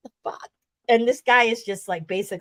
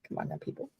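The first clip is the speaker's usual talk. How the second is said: muttered aside; 4 semitones lower, 14 dB quieter, faster than usual. Real, not acted. Ladies' night aside.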